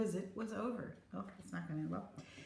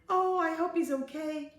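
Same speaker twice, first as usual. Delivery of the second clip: high, loud fretting; worried teacher; 9 semitones higher, 5 dB louder than usual. A narrator's reading. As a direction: fret aloud for a missing child.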